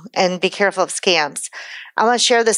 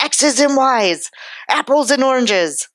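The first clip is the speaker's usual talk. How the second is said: a touch of an exasperated grandpa, higher and slow; hint of a frustrated grandpa tutoring; mock angry grandpa; 5 semitones higher, slower than usual. Grandpa scolding her over algebra, lightly voiced and exasperated. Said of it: "he'd get so mad at me".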